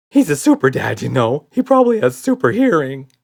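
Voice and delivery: fretting in a rising panic; nervous monster. A narrator's reading. Red warns Buddy, loud and nervous. Red frets about super dad.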